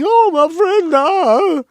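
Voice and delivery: hammed-up high wail of grief; fake-sob it way up high and loud; overacted mourning cry for a dead friend; mock crying. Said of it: "[name] is crying".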